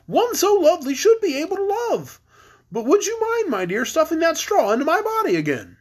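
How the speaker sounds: very high, theatrical straw man; high swooping storybook character; Scarecrow voice. Acted. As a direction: pitch it way up as a courteous Scarecrow.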